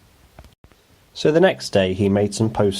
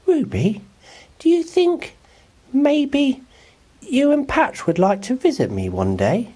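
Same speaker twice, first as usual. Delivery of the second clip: put-on high boy voice, tentatively hopeful; Oliver hopeful. A narrator's reading. Oliver inviting Ruby to visit, high hopeful boy voice. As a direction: ask shyly with a new idea. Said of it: "Then he had a thought".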